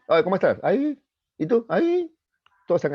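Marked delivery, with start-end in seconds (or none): 0.1-0.6 high, loud mock-polite greeting; perfunctory greeting. Man asks another just to comply, perfunctory. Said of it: "pregunta por cumplir nomás". 0.6-2.1 very high, squeaky mock reply; perfunctory reply. Two men exchange empty greetings, mock perfunctory. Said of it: "pregunta por cumplir nomás".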